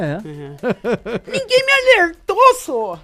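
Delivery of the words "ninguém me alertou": mocking whine. Mocking Zema's excuse.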